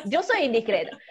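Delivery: usual speaking voice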